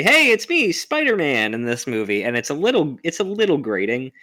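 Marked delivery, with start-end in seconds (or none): 0.0-1.5 high, loud hammed-up cheery hero; mock Spider-Man quip. Mocking the movie's Spider-Man, high and loud. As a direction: chirp it like a cocky hero. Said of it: "he's very, very".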